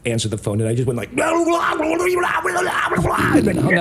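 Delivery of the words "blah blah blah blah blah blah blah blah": high, rapid gibberish babble; guttural noises. Retold or imitated. Prank caller babbling into an elderly lady's phone, guttural noises. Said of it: "like, guttural noises in the phone".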